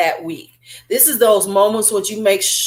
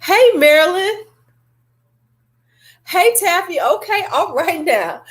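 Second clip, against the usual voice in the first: excited greeting; 9 semitones higher than usual. Real, not acted. Vlogger calling out a cheery hello to Marilyn and Taffy. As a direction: sing out a happy hello across the room.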